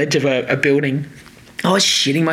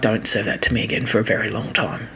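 low, softer weary groan; fed-up groan; 6 semitones lower, 4 dB quieter than usual. Retold or imitated. Telling herself off cauliflower rice, low weary groan. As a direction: sound sick of it.

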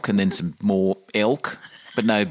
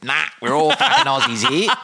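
cheeky Aussie reply; 5 dB louder than usual. Retold or imitated. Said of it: "This chick went".

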